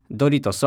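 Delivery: ドリトス said the American way, "duh-REE-dohs"; 英語発音. Shown as a demonstration. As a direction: stress the REE in ドリトス, English vowels. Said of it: "発音は". (ドリトスを英語式に「ドリー」を強く)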